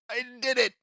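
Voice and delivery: high-pitched victory cry; triumphant cry. Acted. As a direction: exclaim it like a winner. Dice winner's triumphant cry.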